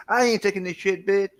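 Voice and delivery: mock a southern American talking tough; southern American street accent.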